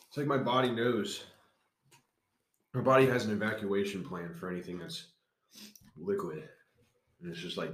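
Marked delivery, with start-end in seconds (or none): none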